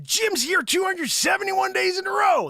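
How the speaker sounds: high, loud hype shout; excited shout. Real, not acted. Host hyping a viewer's streak, loud and high. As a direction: shout his streak to the room.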